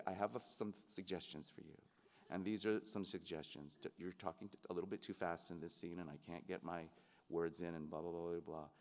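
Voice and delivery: soft, low, tactful imitation; gentle. Leading man giving suggestions to his young co-star, gently. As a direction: offer it gently, keep the voice down. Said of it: "he would very gently say".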